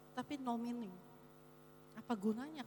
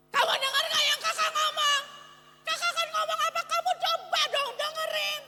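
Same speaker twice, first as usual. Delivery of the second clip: put-on much higher, much louder angry teacher shouting at kids; overloud scolding; 11 dB louder than usual. Shown as a demonstration. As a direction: yell it like you're fed up with the class. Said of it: "terlalu besar sampai kayak orang marah-marah".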